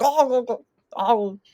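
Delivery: high cartoon "oh" noises; high mock yelps, acting out the scramble; imitated character noises. Acting out going in his mouth, high cartoon yelps. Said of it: "He's like".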